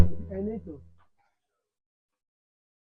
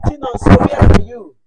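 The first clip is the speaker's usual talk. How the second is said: calling out; 2 semitones higher, 16 dB louder than usual. Pretend. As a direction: shout the name across a yard. Teacher calls out pupils' names.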